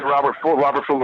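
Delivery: ordinary talk, nothing marked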